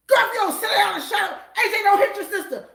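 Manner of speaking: high, loud scolding; scolding yell. Retold or imitated. Mother scolding the kids in the backseat. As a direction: snap at the kids.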